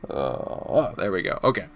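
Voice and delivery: ordinary talk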